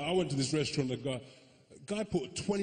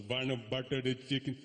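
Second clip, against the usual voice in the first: mock-Indian accent, ordering; overused Indian accent. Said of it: "I did that too much".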